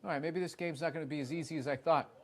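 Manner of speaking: ordinary delivery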